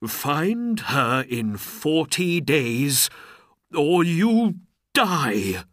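slow, loud royal threat; high, forceful, drawn-out menace; threatening ultimatum. A narrator's reading. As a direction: hit the last words hard as a death threat. Sultan threatens Aladdin with death.

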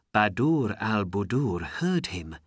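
usual speaking voice

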